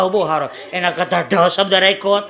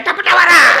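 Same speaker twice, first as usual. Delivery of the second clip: high, loud punchline; 9 semitones higher, 8 dB louder than usual. Retold or imitated. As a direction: slam the punchline home. Punchline answer. (ઊંચા મોટા અવાજે પંચલાઈન)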